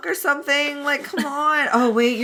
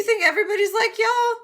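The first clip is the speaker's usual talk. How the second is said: mock indignant; 12 semitones higher than usual. Wei Wuxian indignant, high.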